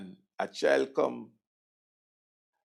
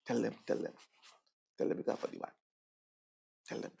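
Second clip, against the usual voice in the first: conspiratorial whisper; 13 dB quieter, faster than usual. Retold or imitated. Government's private instruction whispered.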